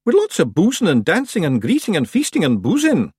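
loud, high Scottish pixie; rowdy wee Scottish Feegle. A narrator's reading. Feegle enthusing.

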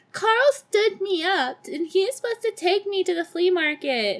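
high, whiny mock sobbing voice; hammed-up tearful complaint; fake crying. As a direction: whine it high like a pathetic fake cry. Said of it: "fake-ass crying at the dinner table".

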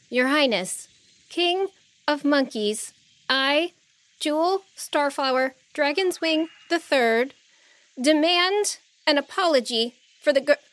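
high-pitched, halting proclamation; brave formal demand. A narrator's reading. Young dragonfly addressing the Monkey King, nervously formal. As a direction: declare it formally in small, careful pieces.

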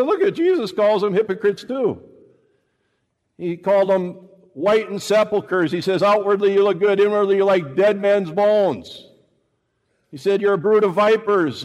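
high, loud preaching; preacher quoting Jesus' rebukes, loud; fiery preaching. Acted. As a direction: preach it with full force. Preaching at full force.

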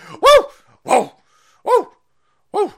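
loud high whoops; whooping. Real, not acted. Hyping up viewers with loud whoops. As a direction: whoop like a crowd. Celebrating gun clip, whooping.

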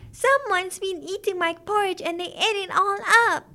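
high, loud little bear voice; crying out as baby bear. A narrator's reading. Baby bear finding porridge all eaten, crying out high. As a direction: wail it in a high child-bear voice. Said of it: "cried the baby bear".

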